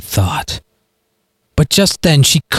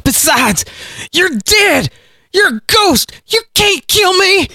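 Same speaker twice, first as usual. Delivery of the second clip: panicked hillbilly. A narrator's reading. Hillbilly taunts Galen in panic.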